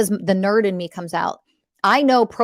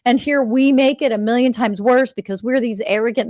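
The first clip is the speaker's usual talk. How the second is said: usual speaking voice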